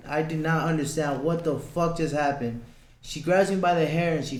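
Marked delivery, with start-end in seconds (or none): none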